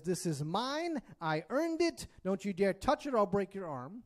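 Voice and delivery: hammed-up defensive brag; mock boastful owner. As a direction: spit it out like a greedy man guarding his stuff. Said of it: "It either says".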